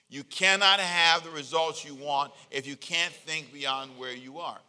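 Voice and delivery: slow, loud, for the church to repeat; call and response. Acted. Pastor leading the church to repeat a line, slow and loud. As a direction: lead the congregation line by line. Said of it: "Say that with me".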